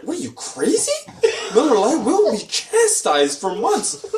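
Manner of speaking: high, slow, laughing mock outrage. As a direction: act scandalized, laughing. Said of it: "we're like".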